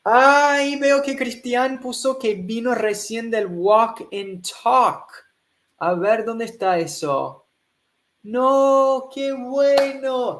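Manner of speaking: high and loud, delighted; bursting with joy at the news; thrilled welcome to a student's message; excited greeting. Real, not acted.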